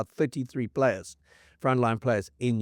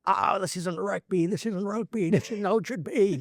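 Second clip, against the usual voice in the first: mock-whiny complaint, pitched higher; imitating sulking Irish supporters, higher voice; whining Irish fans; 7 semitones higher than usual. Mocking Irish fans moaning about a brutal All Blacks match, whiny and higher. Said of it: "the Irish were just".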